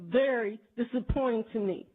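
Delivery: ordinary delivery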